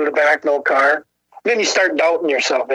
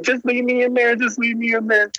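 higher, lightly imitated tearful pleading; mock crying; 6 semitones higher than usual. Girlfriend begging to be left in jail, mock crying. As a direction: plead in a pitched-up mock weepy voice. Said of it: "Jenny's crying all the time".

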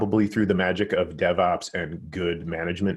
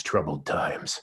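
movie announcer; 4 semitones lower, 4 dB quieter, slower than usual. Pretend. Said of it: "need to get the movie announcer voice going".

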